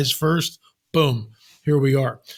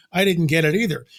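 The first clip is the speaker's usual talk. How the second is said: ordinary delivery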